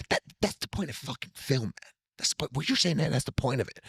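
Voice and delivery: an intense, muted Tarantino; a quiet imitation of Tarantino making his point; Tarantino quote. Quoting Tarantino on film.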